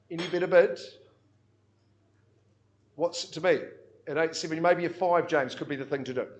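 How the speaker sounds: loud, rhythmic auctioneer calling bids; auction bid spotter. Acted. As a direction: drive the room, call each bid out.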